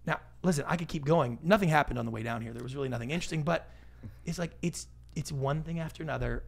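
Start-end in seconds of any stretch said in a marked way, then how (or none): none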